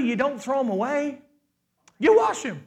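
ordinary talk, nothing marked

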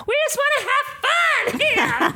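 very high, slow mock-drunk squeal; drunken woman's shriek. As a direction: squeal it like a rowdy drunk reveller. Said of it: "she was just fucking drunk off her ass".

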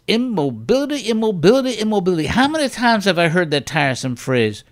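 high, loud preacher cry. Retold or imitated. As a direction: rail it out like a faith healer. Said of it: "and Jack Burns says".

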